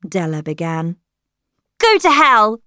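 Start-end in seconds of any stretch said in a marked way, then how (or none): 1.8-2.6 high, loud angry outburst; furious shout. A narrator's reading. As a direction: hurl it at them at full voice. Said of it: "Lily shouted".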